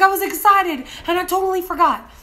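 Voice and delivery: high, loud flustered excuse; defensive excuse. Retold or imitated. Retold excuse to angry driver.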